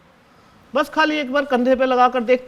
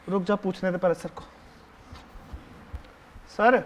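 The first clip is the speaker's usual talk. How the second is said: ordinary delivery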